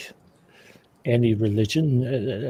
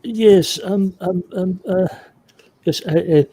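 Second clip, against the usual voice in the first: higher, awkward umming; flustered hesitation; 7 semitones higher than usual. Acted. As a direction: stall awkwardly, lost for words. Awkwardly fielding a smelly hug.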